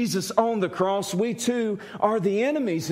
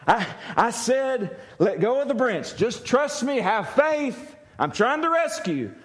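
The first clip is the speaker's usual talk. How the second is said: firm, raised-pitch urging; insistent repeat; 3 semitones higher than usual. Acted. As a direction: press him to obey and trust you. Repeats the command more insistently.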